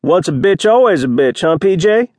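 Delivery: high-pitched sneering jeer; curled-lip contempt. A narrator's reading. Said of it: "Mike said with curled lips".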